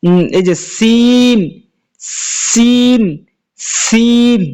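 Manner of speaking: "সিন" with a thin, light s, drawn slow at first; thin seen. Shown as a demonstration. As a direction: keep the "সিন" hiss thin and slight. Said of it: "এটার আওয়াজ চিকন".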